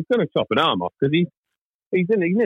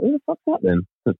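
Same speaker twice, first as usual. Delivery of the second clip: puzzled; 7 semitones higher than usual. Retold or imitated. Retold puzzled reaction.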